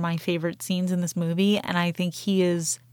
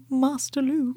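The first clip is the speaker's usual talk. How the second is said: higher-pitched droid impression; C-3PO; 7 semitones higher than usual. Podcaster quoting the droid greeting Luke, C-3PO impression. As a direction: call out in C-3PO's high voice. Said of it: "a very good C three P O impression".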